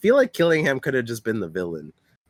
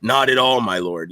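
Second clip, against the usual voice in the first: low, smooth charming villain; smooth rizz voice; 3 semitones lower than usual. Retold or imitated. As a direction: drop into a suave lower voice. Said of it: "turns on the Riz".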